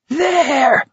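very high, drawn-out screech; shrill shrewish cry; shrewish scream. A narrator's reading. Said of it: "Barkovich screamed shrewishly".

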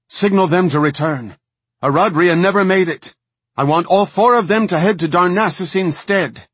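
loud, high, urgent command; shouted orders. A narrator's reading. Commander ordering her second to recall the scouts, shouting urgently. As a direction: call out orders with full urgency. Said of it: "in response to Haldrissa's shouting".

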